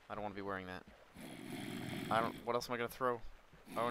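ordinary talk

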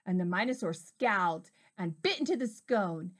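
ordinary delivery, nothing marked

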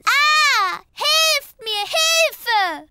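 high, wailing call for help; mock cry for help. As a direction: imitate Mama wailing for help. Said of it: "sie hat gerufen".

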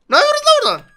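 shrieking comic outburst; angry yell. Real, not acted. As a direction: bellow at them from afar. Yelling at game characters.

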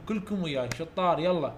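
normal talking voice

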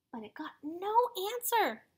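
usual speaking voice

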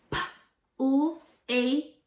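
"P-L-A-T" as separate slow speech sounds; "P-L-A-T" sound by sound, gaps between; word chopped into sounds. Shown as a demonstration. Teacher segmenting plate for kids, "P-L-A-T" chopped up. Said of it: "I'm going to chop up a word".

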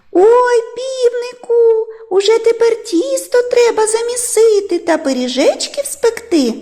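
very high, squeaky mouse voice; thin, high-pitched mouse chatter; little mice. A narrator's reading. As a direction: pipe it up as excited tiny mice. Mice greet rooster gladly.

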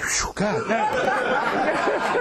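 long, drawn-out phew of relief; huge relief. Acted. Man spared a beating by the crowd, relieved. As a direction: let the danger go, very slowly. Relief after danger has passed.

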